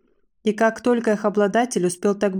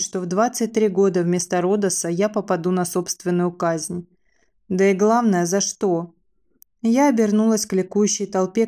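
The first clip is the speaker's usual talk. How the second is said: ordinary delivery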